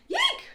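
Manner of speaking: very high startled shriek. Acted. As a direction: squeal in fright. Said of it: "shrieked the farmer".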